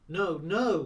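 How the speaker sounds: loud, high cry of protest; almost scream it; forgetting his dignity, near-screaming no. A narrator's reading. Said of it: "Morgan almost screamed".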